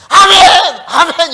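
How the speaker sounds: high, loud imitated outburst; sudden shouted cry; big-voiced amen. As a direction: blurt it out at full volume like that worshipper. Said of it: "막 큰 소리".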